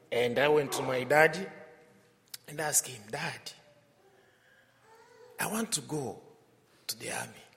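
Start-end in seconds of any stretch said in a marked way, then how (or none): 3.1-7.4 soft, hesitant retold plea; hushed, halting quote, trailing away; timid request. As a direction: re-enact a boy cautiously asking his dad. Reenacts boy timidly asking his father.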